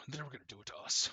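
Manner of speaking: rushed, weird otherworldly voice in the low register. Acted. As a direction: race through it in a distorted, eerie voice. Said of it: "like, high and low pitch, this weird voice".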